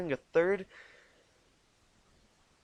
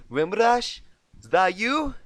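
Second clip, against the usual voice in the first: high, loud Southern call; Louisiana accent; 8 semitones higher, 10 dB louder than usual. Acted. Applejack calls to Rainbow in Louisiana Southern. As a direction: call over the wall, Louisiana twang. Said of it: "Louisianan southern".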